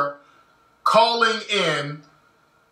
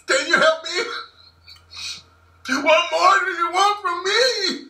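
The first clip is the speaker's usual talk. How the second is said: high, hammed-up frightened pleading; fake scared caller; 11 semitones higher than usual. Pretend victim calling the police, high panicked pleading. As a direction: play the helpless victim on the phone. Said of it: "it's cool to buck your eyes".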